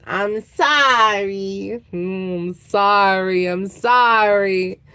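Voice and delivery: slow, drawn-out mock pleading; hammed-up sorry plea; pleading apology. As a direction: beg forgiveness, stretching it out. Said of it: "plead their case".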